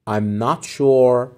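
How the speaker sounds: very slow, careful teacher read; slow model phrase. Shown as a demonstration. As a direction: say it slowly for learners. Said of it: "teacher models phrase".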